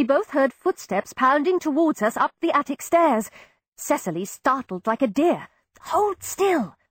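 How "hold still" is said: high, tense order to keep still; high, tense hush; urgent command. A narrator's reading. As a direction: snap her into stillness. Urgent command while cutting hair.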